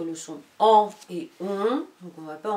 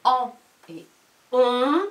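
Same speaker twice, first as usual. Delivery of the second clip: en et on, each said louder and apart; sounds contrasted; 4 semitones higher, 7 dB louder, slower than usual. Shown as a demonstration. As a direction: make the two sounds clearly different. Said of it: "que tu entendes avec tes oreilles".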